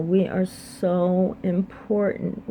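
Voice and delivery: slow emphatic. Real, not acted. Repeating her message with weight.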